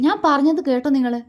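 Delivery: furious wife demanding an answer; angry demand. A narrator's reading. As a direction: snap it at him in anger. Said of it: "ദേഷ്യത്തോടെ ചോദിച്ചു".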